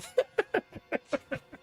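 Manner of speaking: a quick high giggle; high laugh. Real, not acted. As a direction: laugh at your own joke. Laughs at his own joke.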